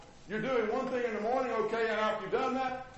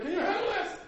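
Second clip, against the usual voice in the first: a higher, lightly voiced request; pushy asker; 10 semitones higher than usual. Preacher voicing someone piling on more work, lightly higher. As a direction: hand it over with a bright ask. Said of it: "they say".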